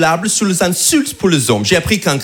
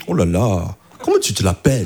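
mock French friends; 13 semitones lower than usual. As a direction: lower voice mocking friends' scoff. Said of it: "tout le monde me dit". (moquerie des amis, voix un peu plus grave)

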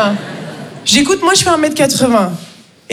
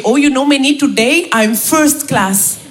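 put-on Indian accent; slower than usual. Raj saying how he is.

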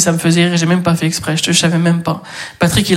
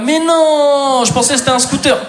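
fake surprise; 7 semitones higher than usual. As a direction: act shocked, hammed-up. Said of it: "on fait semblant... on est surpris".

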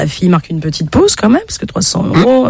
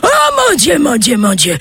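pitched-up mock cry of oh mon Dieu; hammed-up old man yelling in rapture; overacted wail of delight; hurling; 11 semitones higher than usual. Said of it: "il se met à hurler".

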